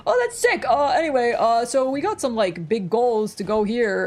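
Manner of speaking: hyped bro boss, slowing at the end. Retold or imitated. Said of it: "he'd be like".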